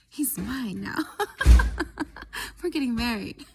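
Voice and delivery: gleeful laughing announcement. Real, not acted. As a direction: laugh out the words, triumphant. Triumphant claim while laughing.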